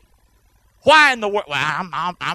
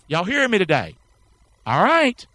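normal talking voice

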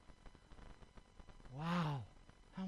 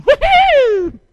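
joyful whoop; 19 dB louder than usual. Real, not acted. Preacher cheering while shedding weights.

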